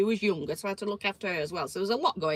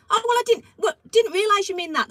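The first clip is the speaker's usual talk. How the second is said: mock flustered man; 11 semitones higher, 9 dB louder than usual. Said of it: "he's going".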